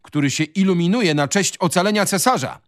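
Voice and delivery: high-pitched heated retort; crying out. A narrator's reading. Son retorting to his father, crying out. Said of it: "krzyknął Adaś".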